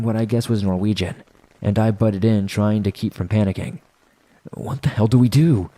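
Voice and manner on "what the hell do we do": mildly urgent, higher-pitched voiced hiss; hissed question. A narrator's reading. Narrator butts in under gunfire, hissed but voiced. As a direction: lift the pitch with a touch of urgency. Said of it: "I hissed".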